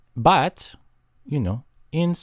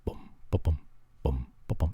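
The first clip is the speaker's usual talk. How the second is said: soft booms; 10 semitones lower, 9 dB quieter than usual. Shown as a demonstration. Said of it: "there's lots of volumes you can go at".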